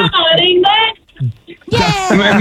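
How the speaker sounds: high, ringing football chant; shouting cheer. Real, not acted. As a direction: belt it out for England. Fan shouting for England.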